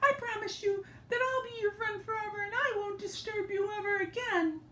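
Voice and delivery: high, soft thankful mouse voice; squeaky grateful mouse. Acted. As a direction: thank the king breathlessly. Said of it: "was very grateful".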